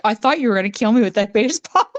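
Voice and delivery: a quick, laughing protest breaking into a chuckle. Retold or imitated. Uncle to his angry wife's sister, laughing. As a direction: laugh through it as if just hit.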